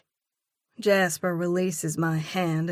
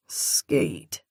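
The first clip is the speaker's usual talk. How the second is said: much lower, slow drawled name; teasing male drawl, much lower; 10 semitones lower than usual. A narrator's reading. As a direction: drawl it low with a chuckle. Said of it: "he drawls with a low chuckle".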